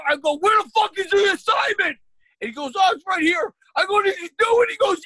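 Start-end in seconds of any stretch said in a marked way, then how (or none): 0.4-2.0 very high, loud exasperated yell; losing it. Retold or imitated. Dad hunting for his kid's assignment, losing it. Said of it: "losing my mind". 2.8-3.5 high-pitched imitation of a kid; child's reply. Kid answering his heated dad, high kid voice. 4.0-4.7 very high, loud strained demand; heated. Retold or imitated. Dad grilling his kid on assignments, heated. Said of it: "getting fucking more and more heated".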